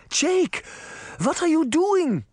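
very high, horrified outcry; shocked grandmother calling out, pitch soaring; alarmed cry. A narrator's reading. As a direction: cry out to stop him. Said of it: "Granny cried".

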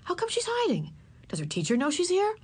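put-on high, curious children's questions; onlookers asking. A narrator's reading. Crowd puzzling over the hidden girl, asking. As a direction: ask like gathering schoolchildren. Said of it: "people asked".

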